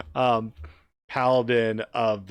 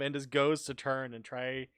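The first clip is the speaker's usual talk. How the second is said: usual speaking voice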